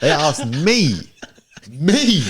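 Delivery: high, rising mock outrage; mock incredulity. Retelling tourists doubting his origin, high mock indignant disbelief.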